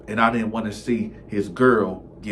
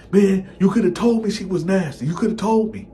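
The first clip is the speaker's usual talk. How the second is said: whiny complaint; 9 semitones higher, 5 dB louder than usual. Retold or imitated. Friend complains.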